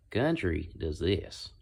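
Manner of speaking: slow, drawn-out country accent. Shown as a demonstration. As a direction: stretch it into a country twang. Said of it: "that's what a country accent is".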